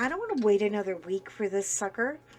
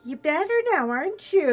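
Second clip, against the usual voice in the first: higher, louder sing-song doctor voice; teasing doctor; 8 semitones higher, 8 dB louder than usual. Retold or imitated. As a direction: tease the patient playfully. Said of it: "the doctor was like".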